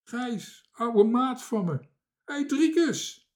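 very high, loud hailing; joyful cry. A narrator's reading. Old sailor mates hail each other from raft and tree, crying out. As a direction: holler to a long-lost mate. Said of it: "klonk er een kreet van het vlot".

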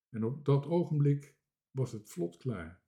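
plain reading voice

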